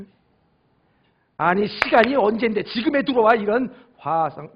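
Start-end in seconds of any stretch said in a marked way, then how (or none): 1.4-4.5 nagging spouse. Acted. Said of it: "이렇게 얘기하면 어떻게 돼?".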